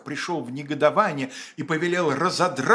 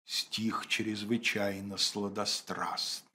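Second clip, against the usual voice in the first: тихий заговорщицкий шёпот; прошепчи, будто это неприлично; 6 semitones lower, 8 dB quieter, much slower than usual. Acted. Вердикт цензора шёпотом. (шёпотом)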